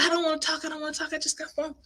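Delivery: high upset huffing refusal; mock huff and puff; upset sister refusing to talk. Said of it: "I was so upset".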